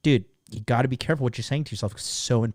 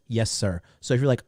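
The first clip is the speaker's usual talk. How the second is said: ordinary talk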